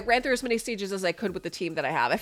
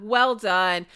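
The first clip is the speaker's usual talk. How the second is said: usual speaking voice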